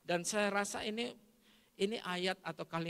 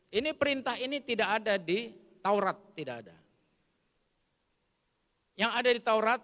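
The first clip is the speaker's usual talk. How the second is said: ordinary talk, nothing marked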